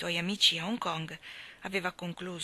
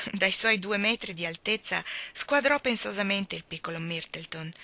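plain reading voice

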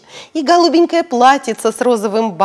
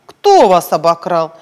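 lower, louder, slow question; policeman; 5 semitones lower, 7 dB louder than usual. Acted. Policeman demanding who robbed them, stern. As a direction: ask sternly.

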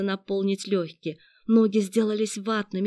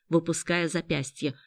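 usual speaking voice

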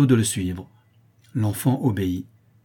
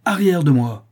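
loud, raised-pitch rebuff; shouted order; 6 semitones higher, 7 dB louder than usual. A narrator's reading. Father driving back his pleading son, shouting. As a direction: shout him away. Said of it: "Mais Matteo lui cria".

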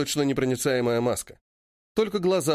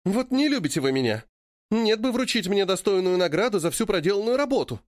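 pouting; 7 semitones higher than usual. A narrator's reading. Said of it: "надувает губки".

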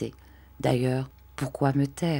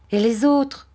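put-on higher, louder child's cry; boy calling out; 8 semitones higher, 5 dB louder than usual. A narrator's reading. Arthur asking after the other animals, calling out. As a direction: call out eagerly as a question. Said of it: "cria Arthur".

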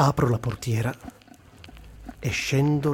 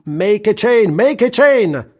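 shouted suggestion; 9 semitones higher, 9 dB louder, much slower than usual. Retold or imitated.

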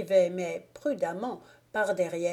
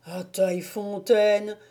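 mildly dumbfounded repetition; astonished echo; 3 dB louder than usual. A narrator's reading. Moquet learning the wolf got away, astonished. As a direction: echo it in disbelief. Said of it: "fit Moquet tout ébahi".

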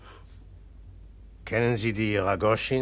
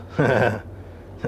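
short mocking laugh. Mocking reply to the patient.

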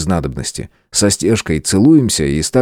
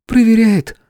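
smiling; 3 dB louder, slower than usual. Acted. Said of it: "улыбается княжна".

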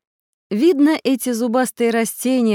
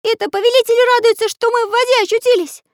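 high, loud, fast character voice, cross; angry grumbling; 7 dB louder, faster than usual. A narrator's reading. Pencil complains in the water, angry and high-pitched. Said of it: "зло пробурчал Карандаш".

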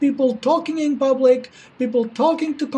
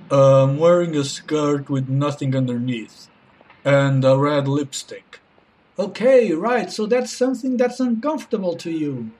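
low, deep mocking male voice; deep man voice; 9 semitones lower than usual. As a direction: drop the voice deep for a guy. Josh character voiced low.

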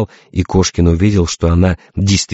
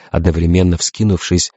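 usual speaking voice

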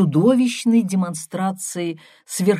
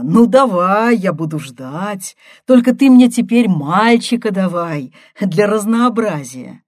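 loud, raised mock encouragement; sarcastic outburst; 3 semitones higher, 8 dB louder than usual. A narrator's reading. Brother responding to pregnancy news, loud and sarcastic. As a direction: throw it out loudly, pitched up.

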